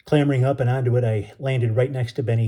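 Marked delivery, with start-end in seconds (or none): none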